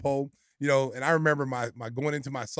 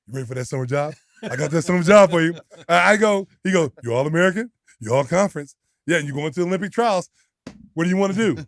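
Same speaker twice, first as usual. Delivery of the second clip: eager coach offer; 3 semitones higher, 8 dB louder than usual. Retold or imitated. Coach voiced offering jobs.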